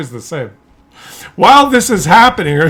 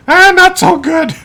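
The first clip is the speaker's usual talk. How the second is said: higher, slow, mopey complaint; mock moaning mother; 10 semitones higher, 3 dB louder than usual.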